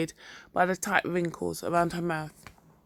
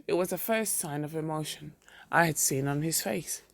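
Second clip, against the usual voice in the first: usual speaking voice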